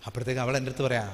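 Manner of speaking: ordinary talk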